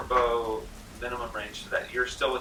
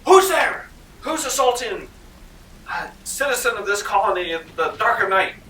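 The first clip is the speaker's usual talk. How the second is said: much higher, louder, outraged gentleman; indignant colonist; 11 semitones higher, 8 dB louder than usual. Acted. Colonist demanding who attacked him in the dark, indignant. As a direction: bluster like an offended citizen. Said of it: "and he goes".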